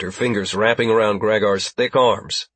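ordinary delivery, nothing marked

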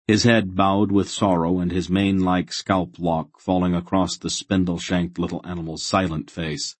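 usual speaking voice

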